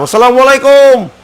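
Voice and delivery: high, loud greeting called across. Shown as a demonstration. Preacher acts out greeting someone across the way, high and loud. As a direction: call the salam out like to a passer-by.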